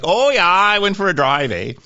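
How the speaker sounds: imitating the folks back home on the prairies; prairie Canadian. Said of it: "it's like".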